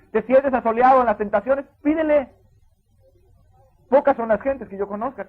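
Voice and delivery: loud, high, slow pulpit exhortation; preaching at full force. Acted. Pastor urging the church to ask God, full force preaching. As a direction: lift the congregation with each line. Preacher urges prayer in temptation.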